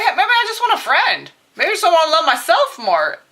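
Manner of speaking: imagined listener. Acted. Voices listener's modest wish.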